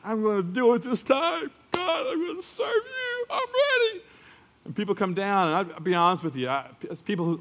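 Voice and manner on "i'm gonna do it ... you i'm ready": emotional vow. Retold or imitated. Churchgoer after worship, tearful high vow. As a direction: voice cracking high with tearful fervour. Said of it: "you're like".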